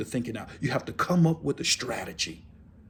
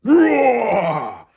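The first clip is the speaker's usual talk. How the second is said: loud, high roar falling away; human lion roar; 6 semitones higher, 7 dB louder than usual. Shown as a demonstration. Preacher demonstrating how to practise your roar, loud roar. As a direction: roar out the word, long and falling. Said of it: "start practicing your roar".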